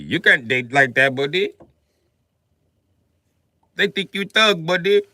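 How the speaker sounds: scolding imitation of an Indian friend; Indian accent. Friend warns him about his clothes, in a mock Indian accent.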